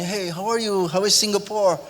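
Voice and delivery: put-on a rich young guest showing off in English; posh English guest. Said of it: "Terus ngomongnya".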